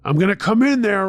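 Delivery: loud, high-pitched threat; angry scream. A narrator's reading. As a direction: yell a threat through the door. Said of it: "Earl screamed".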